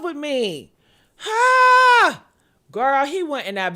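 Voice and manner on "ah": loud, high anguished cry; high loud wail. Acted. Mimics his anguished reaction.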